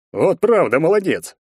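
jeering congratulation with swooping pitch; mocking praise. A narrator's reading. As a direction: overdo the compliment to taunt him. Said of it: "Он явно издевался".

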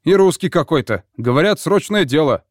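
loud call through a door. Acted. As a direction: shout to wake the captain. Sailor calls through cabin door.

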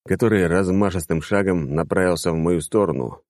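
usual speaking voice

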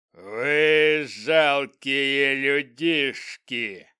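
booming slow goblin chieftain; dark lord. Acted. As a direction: roar it slowly like a giant goblin. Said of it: "громогласно произнёс он".

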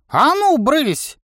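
high, loud grouchy shooing; grumpy shout. A narrator's reading. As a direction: shoo them off with a crabby cry. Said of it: "ворчливо крикнул тренер". (ворчливый окрик)

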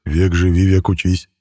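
plain reading voice

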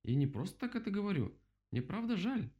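high-pitched, eager apology; joyful relief. A narrator's reading. Rabbit bouncing with joy as he apologises, excited. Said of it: "от радости и облегчения".

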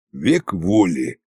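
low, slow vow; solemn oath. A narrator's reading. Koschei promising to stop meddling, solemn oath. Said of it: "поклялся Кощей".

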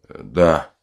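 subdued, faint answer; barely breathing. A narrator's reading. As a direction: answer weakly, short of air. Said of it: "еле дыша". (еле дыша, слабо)

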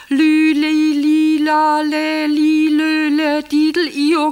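loud, high sung Lü li la; sung birdcall. Acted. Wife singing the nightingale code into the receiver, loud and high. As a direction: sing the trill like a warbler. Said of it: "statt Lü, li, li".

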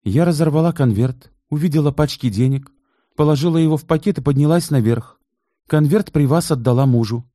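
high female voice, quick; voiced wife. A narrator's reading. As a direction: voice the wife explaining. Taisiya explains, high female voice. (высокий голос жены)

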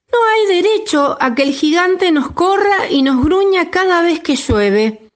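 high, bold little voice; brave chick voice. A narrator's reading. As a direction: squeak out a brave protest. Chick's speech from a bench.

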